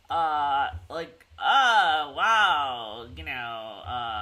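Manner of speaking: laid-back surfer dude drawl; lazy surfer-dude voice. Retold or imitated. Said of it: "speaking like a surfer dude".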